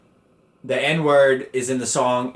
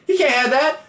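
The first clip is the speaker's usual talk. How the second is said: mom's alarmed cry, much higher; imitating mom; 14 semitones higher, 3 dB louder than usual. Mom stopping him feeding the baby, imitated alarm. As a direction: squeal it like a panicked mother. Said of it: "She's like".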